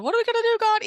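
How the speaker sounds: anxious high-pitched plea; panicked cry. Retold or imitated. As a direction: cry out in mock panic to God. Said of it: "So his heart was going".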